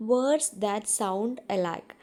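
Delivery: each word drawn out very slowly; teacher reading a definition aloud, very slow; slow dictation. Shown as a demonstration. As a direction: spell out the definition slowly for the class. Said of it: "homophones എന്ന് വെച്ചാൽ എന്താ നോക്കൂ".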